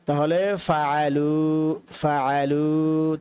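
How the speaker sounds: ফাআলু spoken very slowly with a long উ; stretched teaching ফাআলু; slow drill. Shown as a demonstration. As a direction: model ফাআলু slowly for copying. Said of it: "ওয়া সাকিনের পরে একটা আলিফ".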